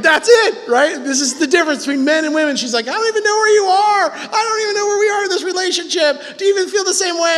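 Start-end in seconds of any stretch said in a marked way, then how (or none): 2.9-7.4 high-pitched, hammed-up wailing complaint; mock a distraught wife's rapid questioning; upset wife.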